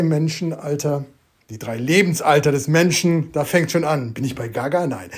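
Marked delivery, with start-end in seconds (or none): none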